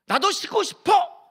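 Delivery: high, slow pleading voice of a weary wife; tired spouse. Acted. Pastor voicing a worn-out spouse, high slow plea. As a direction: whine it like an exhausted spouse.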